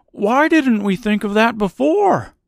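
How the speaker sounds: high-pitched scarecrow exclamation; crying-out scarecrow. A narrator's reading. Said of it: "cried the Scarecrow".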